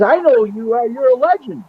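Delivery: high, animated quoted exclamation; retold star-struck excitement; impressed recognition. Quoting an awed man recognizing him.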